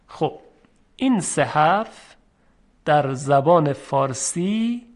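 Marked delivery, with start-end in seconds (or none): none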